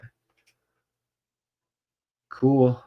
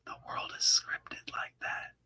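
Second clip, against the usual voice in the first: hushed secret-telling whisper; conspiratorial whisper; 14 dB quieter than usual. Acted. Conspiratorial aside to viewers.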